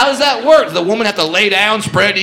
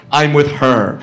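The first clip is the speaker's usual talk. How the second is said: smug comeback; 4 semitones lower, slower than usual. Retold or imitated. Guy's smug reply to heckler.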